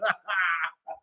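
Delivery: high, quiet nervous laugh; a short uneasy chuckle. Real, not acted. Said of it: "that was a nervous laugh".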